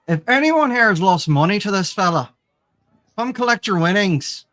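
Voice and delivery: ringing invitation to the crowd; public announcement. Acted. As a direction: proclaim it to the whole tavern. Player announces to the tavern.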